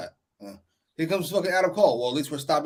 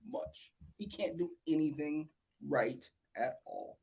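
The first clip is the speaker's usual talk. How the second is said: usual speaking voice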